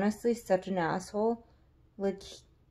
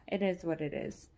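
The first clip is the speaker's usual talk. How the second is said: ordinary delivery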